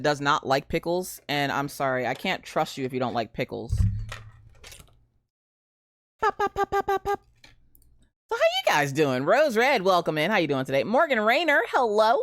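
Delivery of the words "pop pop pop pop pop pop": popping noise. Real, not acted. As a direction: pop your lips lightly, up high. Streamer makes popping noise.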